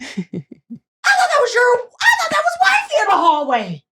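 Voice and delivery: high, loud, drawn-out shocked cry; outraged disbelief. Retold or imitated. Calling out a cheater about the hallway girl, high shocked shout. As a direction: wail it out high and slow, scandalised. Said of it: "I said".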